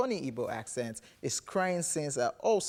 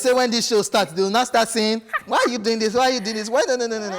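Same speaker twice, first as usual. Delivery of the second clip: high, loud mock motivational speech; motivational speaker; 6 semitones higher, 9 dB louder, faster than usual. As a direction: imitate an over-the-top motivational speaker, pitched up and loud. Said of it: "just sounded like all those motivational speakers".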